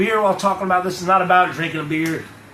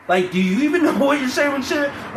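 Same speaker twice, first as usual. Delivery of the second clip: incredulous; 7 semitones higher than usual. Retold or imitated. Repeating the challenge, laughing.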